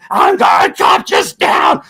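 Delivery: high, loud, slow yell of a drugged man; crazed shout. Retold or imitated. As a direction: bellow it like a naked guy swinging a tomahawk. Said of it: "He was trying to".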